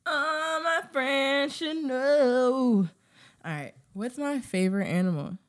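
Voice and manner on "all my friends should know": drawn-out, very slow echo; slow exaggerated repeat; slow mock echo. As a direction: stretch every word out teasingly.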